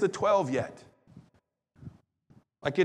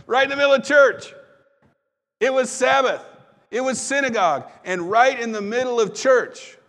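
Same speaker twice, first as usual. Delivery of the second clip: louder and higher, stressing each place; excited emphasis; 7 semitones higher, 7 dB louder than usual. Real, not acted. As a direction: drive home the shock of it happening in church. Preacher stressing the shocking setting.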